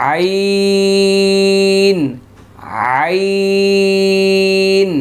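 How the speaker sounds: আইন drawn out long from the throat, almost sung; slow tajweed letter demo. Shown as a demonstration. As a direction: stretch আইন slowly, high and held. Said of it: "হামজা".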